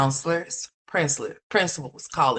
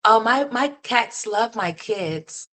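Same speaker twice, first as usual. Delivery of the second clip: higher, slow mock mom voice; mocking quote; 6 semitones higher, slower than usual. Viewer mocking the mother's slow, silly claim. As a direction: mimic the mother with a slow sneer.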